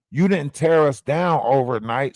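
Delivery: ordinary delivery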